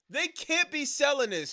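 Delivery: They can't be selling this, yelled high and loud; incredulous yell. Real, not acted. Streamer yelling at the merch, They can't be selling this high and loud.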